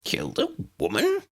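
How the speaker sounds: high shocked squeaky professor; shocked squeak. A narrator's reading. Tiny professor hears of a killing, high shocked squeak. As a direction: squeak in disbelief.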